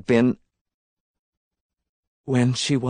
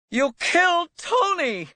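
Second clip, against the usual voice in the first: screamed accusation. A narrator's reading. Elizabeth accusing Tony's killer, screaming. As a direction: scream it at him, pitch shooting up. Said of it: "She screamed it at him".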